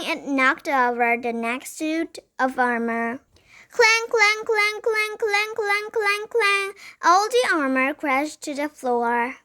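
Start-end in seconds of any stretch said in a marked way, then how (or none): 3.7-6.7 high, sing-song run of clangs; imitated clanging. A narrator's reading. All the armor crashing down, high imitated clanging. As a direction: chant the crashes on one high note.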